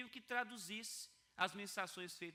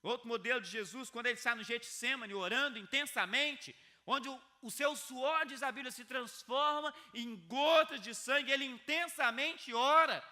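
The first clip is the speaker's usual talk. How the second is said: full-force preaching; 6 semitones higher, 9 dB louder than usual. Acted. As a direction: preach it out loud to stir the room.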